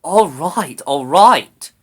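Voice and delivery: loud, grudging concession; muttered concession. A narrator's reading. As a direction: give in crossly. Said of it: "she muttered".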